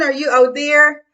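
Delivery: ordinary delivery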